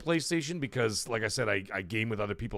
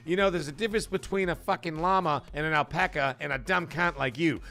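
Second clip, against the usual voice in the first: raised-pitch Aussie telling-off, lightly imitated; mock annoyed Australian; 4 semitones higher than usual. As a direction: imitate an Australian getting pissed off. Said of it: "especially when they get pissed off".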